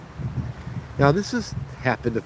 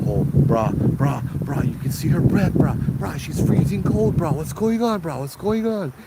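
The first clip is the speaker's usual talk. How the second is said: much higher, panicky repeated brah; freaking-out boyfriend; 6 semitones higher than usual. Retold or imitated. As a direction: imitate a scared boyfriend losing it. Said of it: "the boyfriend started freaking out".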